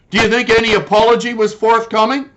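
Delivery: usual speaking voice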